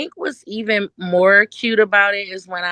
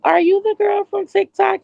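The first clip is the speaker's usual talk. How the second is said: put-on high-pitched child voice; small high voice asking eagerly; little girl; 12 semitones higher than usual. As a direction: imitate a curious young girl. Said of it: "She was about nine years old".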